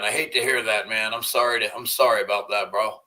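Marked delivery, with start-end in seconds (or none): none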